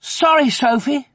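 put-on high, loud boy's apology; high-pitched, urgent boyish exclamation; alarmed cry. A narrator's reading. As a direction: cry out a startled sorry. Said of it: "the kicker cried".

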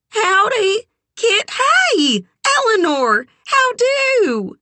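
loud, high, drawn-out greeting call. A narrator's reading. Sugar greets Kit and Eleanor from outside, calling out high and loud. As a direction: holler a very high-pitched hello. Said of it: "Sugar called out".

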